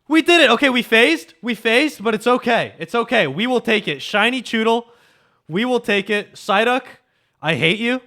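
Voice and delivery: high, loud real celebration; excited shouting. Real, not acted. Streamer to chat on finally finding a shiny, excited shouting. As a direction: shout it out in elated relief.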